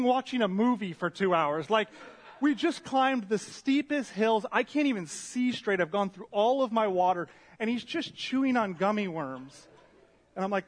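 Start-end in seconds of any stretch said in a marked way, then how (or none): 2.4-9.7 loud, high comic rant; retold indignation. Worn-out rider griping about an unbothered partner, indignant. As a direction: play up the disbelief, pitched high and loud.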